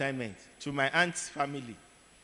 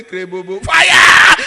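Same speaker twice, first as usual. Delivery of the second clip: much higher, much louder fire command; commanding shout; 8 semitones higher, 22 dB louder, slower than usual. Retold or imitated. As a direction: blast the fire command like a pastor.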